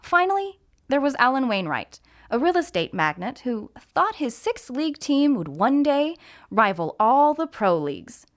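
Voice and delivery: plain reading voice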